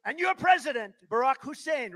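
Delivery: high, loud announcing call; rally shout. Retold or imitated. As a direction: boom it to the crowd. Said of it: "I'll say".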